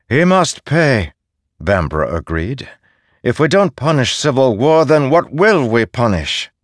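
high, loud, unforgiving; demanding punishment. Acted.

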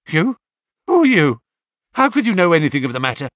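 high, fast frightened questions; startled. A narrator's reading. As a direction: blurt it out in alarm. Startled man questions Holmes.

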